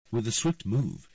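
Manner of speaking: usual speaking voice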